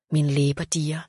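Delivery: plain reading voice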